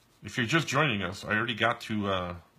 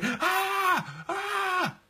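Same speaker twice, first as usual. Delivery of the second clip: sudden high-pitched "ah" screams; demonstrative scream. Shown as a demonstration. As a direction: scream out of nowhere to jolt a relaxed listener. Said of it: "What happens if I just start screaming".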